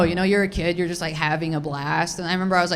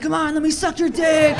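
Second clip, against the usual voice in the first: eager pleading; 8 semitones higher than usual. Retold or imitated. Teenager chasing guys around, high eager pleading. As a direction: beg them as you chase after them.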